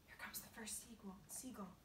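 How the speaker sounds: hushed warning whisper. A narrator's reading. James warning of the first seagull, whispering. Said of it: "whispered James".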